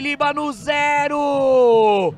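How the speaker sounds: loud, high, drawn-out replay call; explosive goal commentary. Real, not acted. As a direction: keep yelling the score, stretching it.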